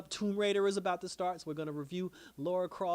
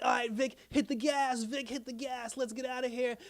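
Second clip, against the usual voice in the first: mock-urgent, high-pitched rush to escape; urgent getaway order; 7 semitones higher than usual. Penguin to Vic as he jumps in the car, mock-urgent. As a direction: retell a frantic order, pitched up.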